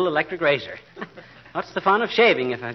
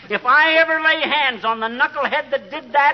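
blustering threat; 9 semitones higher than usual. Acted. McGee threatening the culprit.

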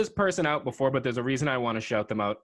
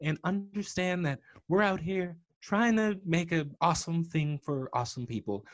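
higher, slightly put-on movie-heroine voice; Rose from Titanic; 6 semitones higher than usual. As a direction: lightly play a heroine, pitched high and measured. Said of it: "I'm gonna be the Rose".

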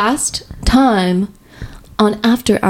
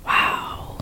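whisper; 11 dB quieter than usual. Real, not acted. Mock shock at skipped cousin.

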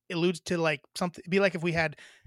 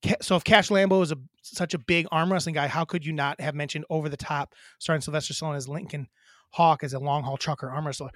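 usual speaking voice

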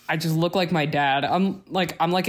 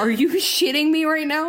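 playful outrage; 10 semitones higher than usual. Real, not acted. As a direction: rant about the height genes in a half-joking fury. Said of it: "I'm so mad".

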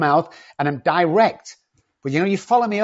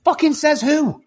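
put-on much higher, scoffing kid's voice, hitting fucking; defiant kid retort; 13 semitones higher, 4 dB louder than usual. A kid scoffs at being told he can't play football, high and mocking. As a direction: mimic a cocky kid throwing it back. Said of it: "one of our biggest sayings today".

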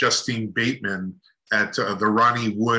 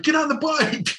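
eager call. Retold or imitated. Photographer urging them.

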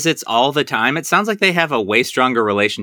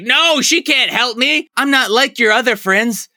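higher-pitched, indignant protest; imitated angry outburst, pitched up; mock angry Hudson; 9 semitones higher than usual. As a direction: act out an angry refusal, hammed up a little. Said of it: "Hudson gets angry".

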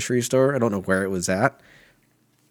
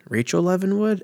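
high, doubtful rise through "Rachel Evan Wood"; unsure, high-pitched query on "Rachel Evan Wood"; questioning; 11 semitones higher than usual. Real, not acted. Unsure of the actress's name order.

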